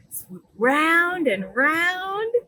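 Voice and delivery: slow, drawn-out round and round; slow swooping delivery. Acted. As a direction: stretch it like circling in the air. Acting out swooping.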